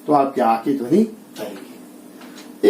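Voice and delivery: ordinary delivery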